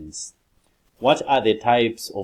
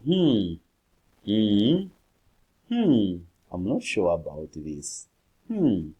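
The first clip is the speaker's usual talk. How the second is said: unsure hmm; 4 dB louder, slower than usual. Shown as a demonstration. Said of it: "sounds of fillers".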